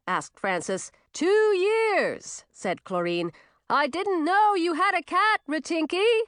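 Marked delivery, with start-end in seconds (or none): 1.1-2.4 high, booming short answer; high-pitched hearty character shout; loud witch. A narrator's reading. 3.7-6.2 high, booming surprised remark; high-pitched hearty character voice; loud witch. A narrator's reading. Loud witch speaks to Ratinky.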